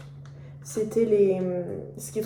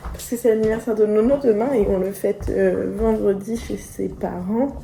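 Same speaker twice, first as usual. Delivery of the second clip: ordinary talk, nothing marked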